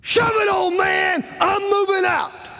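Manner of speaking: yelled high and loud, furious; angry shout. Retold or imitated. Son yelling at his father while storming out, furious shout. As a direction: blow up at the old man.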